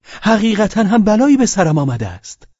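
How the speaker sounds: high, loud cry; shouting. Acted. Pavel Pavlovich crying out to Velchaninov in a loud high voice. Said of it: "فریاد کشید".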